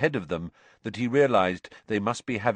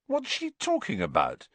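baffled; 10 semitones higher than usual. A narrator's reading. Ron baffled after Hermione storms off.

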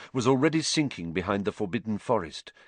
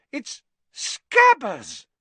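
very high, slow, loud squeal; drawn-out high-pitched shocked cry; shrieked disbelief; 6 dB louder, much slower than usual. A narrator's reading. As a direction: squeal it out in amazement. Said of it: "she let out a shriek".

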